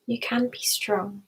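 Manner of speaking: ordinary talk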